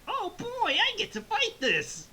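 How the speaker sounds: high, loud hammed-up glee; excited. Goku finally getting to fight, hammed-up excitement. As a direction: act thrilled in a put-on high voice. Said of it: "Goku gets excited".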